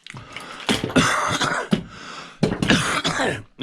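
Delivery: long dry cough fit; hacking cough from dust in the throat. Real, not acted. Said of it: "got dust in my throat".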